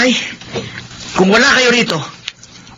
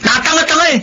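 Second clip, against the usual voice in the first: scornful; 14 semitones higher than usual. Acted. Son mocks the old man.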